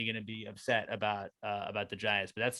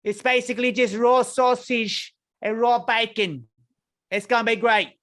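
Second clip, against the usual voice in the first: high, louder hammed-up British voice; mock British accent; 9 semitones higher, 5 dB louder than usual. Praising raw sausage in a silly British accent. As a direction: ham up a cheery English host.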